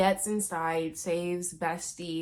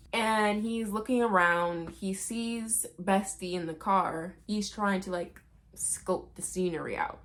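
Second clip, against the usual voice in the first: ordinary delivery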